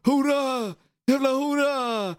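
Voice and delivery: high, drawn-out imitation of a yell; mock drunken yell. Drunk lad yelling nonstop from the stand, mock yell. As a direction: stretch it out like a drunk heckler. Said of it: "Så han skrek ju hela tiden".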